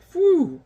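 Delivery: big high puff of breath; giant blowing. Acted. Giant puffing smoke away from the town, loud phew. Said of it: "so he's like".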